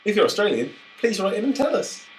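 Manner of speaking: high, loud appeal to Australian listeners; calling out. Real, not acted.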